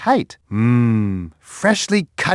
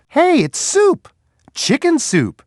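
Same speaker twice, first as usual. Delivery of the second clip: much higher, delighted cry; excited discovery; 11 semitones higher than usual. Acted. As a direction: announce the find with glee. Discovering the smell's source.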